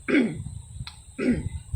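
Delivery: throat clearing; clears throat. Real, not acted. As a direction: clear your throat roughly. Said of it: "frog in my throat".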